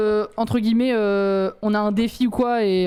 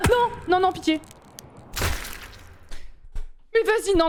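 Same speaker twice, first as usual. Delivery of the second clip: high desperate pleading; panicked pleading; 8 semitones higher than usual. Real, not acted. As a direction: beg as you fall. Dying in the game.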